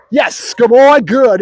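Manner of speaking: high, emphatic dog praise; excited praise. Real, not acted. Trainer rewards Bruno for barking, excited praise. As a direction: celebrate the bark.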